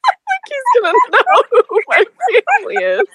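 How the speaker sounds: high, giggling through the line; can't stop laughing while telling it; laughing retelling; laughing through words. Real, not acted. Laughing while quoting herself.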